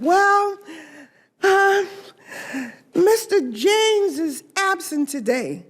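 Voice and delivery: high, tearful little-girl voice, retold; imitating a crying girl. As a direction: voice the little girl close to tears, pitched high. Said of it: "she was crying".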